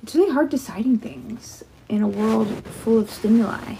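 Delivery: breathy hushed whisper. Real, not acted. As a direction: whisper it close to the mic. Whispers a thought aloud.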